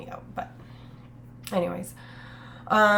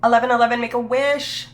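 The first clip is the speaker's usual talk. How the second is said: excited call-out; 4 semitones higher, 8 dB louder than usual. Real, not acted. Spotting 11:11 on clock.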